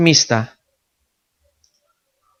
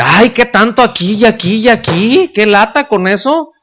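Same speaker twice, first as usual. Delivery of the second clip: mock whiny complaint; 4 semitones higher than usual. Believer quoted complaining.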